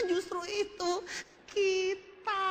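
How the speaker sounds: high, stretched-out mock answer; floundering salesman. Speaker has no local success story, high hammed-up floundering. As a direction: drag out the evasive line in a strained high voice. Strained repeat of evasive answer.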